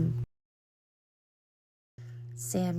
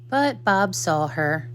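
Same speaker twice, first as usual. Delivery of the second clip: usual speaking voice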